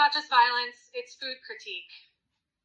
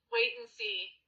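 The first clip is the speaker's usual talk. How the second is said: mock calm; 11 semitones higher, 7 dB quieter than usual. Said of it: "chilling like".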